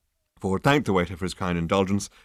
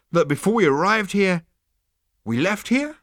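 high, louder puzzled question; incredulous; 11 semitones higher, 6 dB louder than usual. Acted. Zaphod repeats the waiter's claim back, incredulous. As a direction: climb higher in sheer disbelief.

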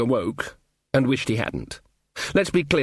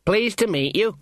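put-on higher-pitched, polite young servant; polite greeting; 8 semitones higher than usual. A narrator's reading. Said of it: "said Sean politely".

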